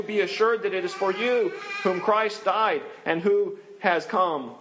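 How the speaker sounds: usual speaking voice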